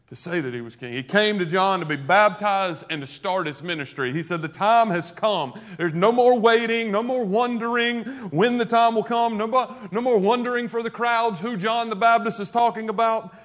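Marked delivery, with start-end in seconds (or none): 4.4-13.3 excited announcement. Acted. Jesus announcing his ministry.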